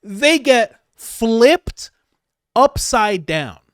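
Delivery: very slow, high and loud, stretched out; loud, slow mock-triumphant call; dragged-out yell. Shown as a demonstration. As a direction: yell it with relish, drawn long.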